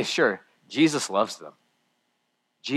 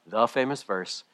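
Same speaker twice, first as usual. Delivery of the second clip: normal talking voice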